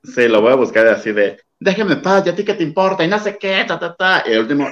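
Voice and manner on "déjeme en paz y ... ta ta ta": high, snappy diva voice; high and dismissive, like an irritated diva; [name] impression. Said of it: "TikTok que le hicieron a [name]".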